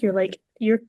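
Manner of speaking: ordinary talk, nothing marked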